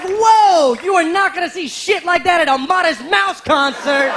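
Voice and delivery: high, loud awestruck; amazed. Retold or imitated. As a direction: gush in amazement. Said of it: "I was like".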